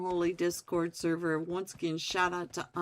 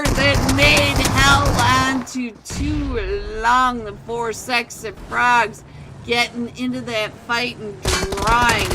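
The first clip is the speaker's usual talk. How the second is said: loud, fast-paced caster call; excited play-by-play; 5 semitones higher, 9 dB louder than usual. Acted. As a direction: call the fight like a hyped caster. Live esports play-by-play.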